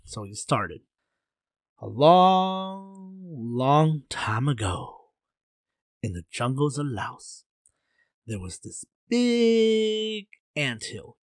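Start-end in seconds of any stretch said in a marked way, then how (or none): 1.8-7.5 long stretched-out fairy-tale opening; drawn-out storytelling. Acted. Zha begins his bedtime story for King, drawn-out storytelling. 8.3-11.2 BIG held very long and high; stretched stress on BIG. Acted. Zha tells King about the anthill, "big" stretched high.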